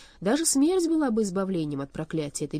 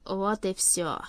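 lifeless; 2 semitones higher, much slower than usual. A narrator's reading. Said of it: "тихий, безжизненный".

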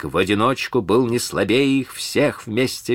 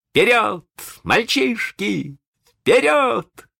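put-on rally the boys to charge; ringing battle cry; 14 semitones higher, much slower than usual. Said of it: "зазвенел голос Питера". (звонкий боевой клич)

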